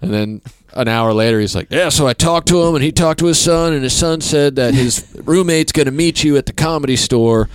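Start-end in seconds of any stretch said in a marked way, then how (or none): 1.7-7.5 eager name-dropper. Retold or imitated. Quoting the same dad.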